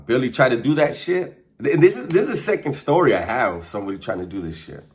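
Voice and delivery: ordinary talk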